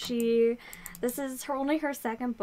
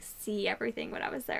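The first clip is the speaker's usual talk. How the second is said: usual speaking voice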